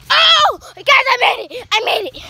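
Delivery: loud, high victory yell; triumphant shout. Real, not acted. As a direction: cheer like you just won. Landing the trick.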